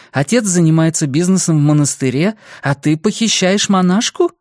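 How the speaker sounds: half-joking threat from mum; indignant mother. Acted. Mother scolding her son about kidnapping a nun, indignant.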